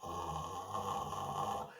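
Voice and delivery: low creaky vocal fry. Shown as a demonstration. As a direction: crackle it down in fry. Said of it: "vocal fry, which is this sound".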